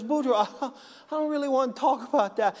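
lightly hammed-up wife ducking the question. Wife answers husband in joke.

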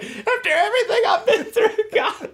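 high, loud, laughing cry to God; laughing shout. Retold or imitated. Quoting his plea to God about the horsefly, laughing and shouting. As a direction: shout it at God while cracking up. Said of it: "I'm like".

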